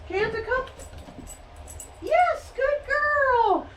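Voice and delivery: bright, high and lively, calling the dog in; high excited praise. Real, not acted. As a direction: cheer her on as she comes. Recall, then praising the dog.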